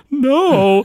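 high, loud mock Homer no; a squeaky cartoon refusal; Homer refusing. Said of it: "And Homer's like".

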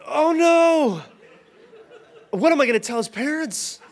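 high, loud panicked cry; freaked-out panic. Retold or imitated. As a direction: panic that you broke the kid. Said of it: "I'm genuinely freaked out".

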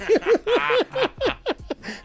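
real high-pitched burst of laughter. Real, not acted. Telling friends the doctor story, real high laugh.

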